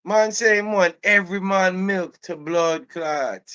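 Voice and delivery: very high, loud Jamaican holler; Jamaican accent. Retold or imitated. Employee calling his coworker Mark over, loud Jamaican outrage. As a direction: call out scandalized in thick Jamaican patois. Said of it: "he's Jamaican".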